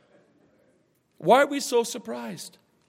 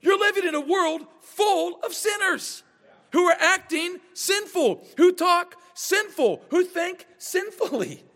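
pitched-up exasperated preacher rebuke; exasperated shout; 9 semitones higher than usual. Retold or imitated. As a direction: call out the offended churchgoers. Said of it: "I'm like".

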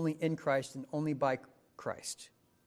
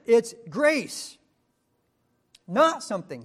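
loud, higher, proclaiming the quote; preaching at full force; 7 semitones higher, 9 dB louder than usual. Acted. Preacher proclaiming Paul's words on the free gift, full force. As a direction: declare it like scripture from the pulpit. Preacher quoting Paul loudly.

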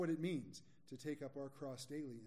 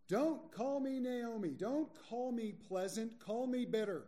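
slow, higher, louder bitter cry; 6 semitones higher, 4 dB louder than usual. Acted. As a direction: spit the words out bitterly. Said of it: "Here we see Naomi's bitterness".

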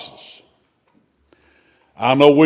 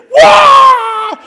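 high frightened wail; scared shriek; 5 dB louder than usual. Retold or imitated. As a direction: shriek like a terrified witch. Witch scared by Samuel.

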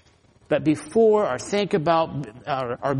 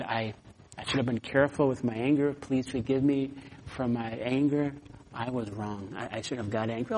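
sincere apology; 3 semitones lower, 6 dB quieter than usual. Retold or imitated. Apologizing to neighbor.

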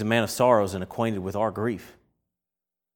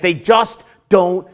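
slow, loud, higher exasperation; righteous anger; 6 semitones higher, 6 dB louder, much slower than usual. Acted. Preacher voicing Jesus at the unbelieving mourners, righteously exasperated. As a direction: punch each word slowly in frustration. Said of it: "in his righteous anger, he's like".

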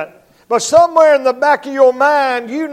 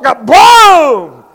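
loud kaboom sound effect; mock crash; 2 semitones higher, 9 dB louder than usual. Owner strikes the dog, loud kaboom noise.

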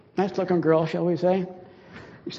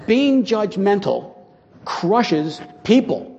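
slow emphasis; 3 semitones higher, much slower than usual. Real, not acted. Preacher stresses the main point.

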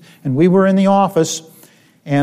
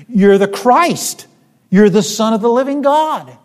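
preacher voicing a disciple, higher and louder; emphatic retold declaration; 7 semitones higher, 5 dB louder than usual. Peter answering Jesus on who he is, emphatic retold declaration.

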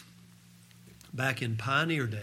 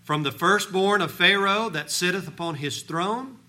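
louder, high-pitched scripture preaching; 6 semitones higher, 7 dB louder than usual. Acted. Preacher reads God's promises to the congregation, forceful preaching. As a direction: proclaim the verses with full conviction.

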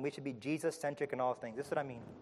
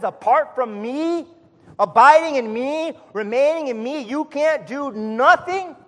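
preacher crying out to his church; full-force preaching; 9 semitones higher, 13 dB louder than usual. Acted. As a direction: hammer each word at the congregation.

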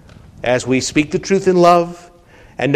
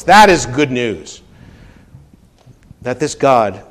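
ordinary delivery

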